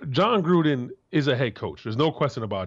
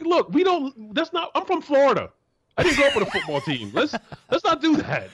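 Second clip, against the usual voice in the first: high, exasperated pleading, ending in a laugh; exasperated plea; 14 semitones higher than usual. Retold or imitated. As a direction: protest to the in-laws, voice way up. Pleading with Pittsburgh in-laws.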